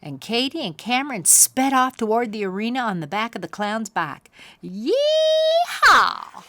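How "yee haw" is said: very high loud cowboy whoop; shouted yee-haw. Acted. Cameron riding the clown's bike, high joyful whoop. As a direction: whoop it out with joy. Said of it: "shouted Cameron".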